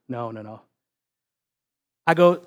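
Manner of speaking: usual speaking voice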